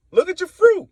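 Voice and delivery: high loud accusing cry; loud condemnation. Real, not acted. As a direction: shout it at them. Condemning his enemies.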